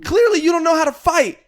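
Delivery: high-pitched imitation of a wrestler girl. Wrestler halting the staged fight before the crowd, high mock protest. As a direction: call a halt in a high, mock-indignant girl's voice. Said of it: "one of the girls goes".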